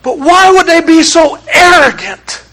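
ordinary talk

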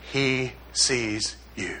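very soft, low and slow; hushed reassurance. Acted. Gospel comforting the believer, hushed, low and slow. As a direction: let each word fall nearly to a hush.

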